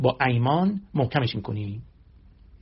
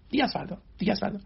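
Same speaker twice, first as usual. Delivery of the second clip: rushed procrastinator's voice; 7 semitones higher, faster than usual. Acted. Voicing the procrastinating inner voice.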